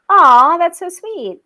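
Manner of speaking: high, drawn-out "aww" of gratitude; touched cooing. Real, not acted. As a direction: coo it slowly, genuinely touched. Touched by a viewer's kind comment.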